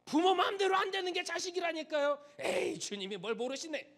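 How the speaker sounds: high, loud, scoffing whine; hammed-up parent dismissing the Lord; mocking excuse. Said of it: "이렇게 말씀하실 거예요".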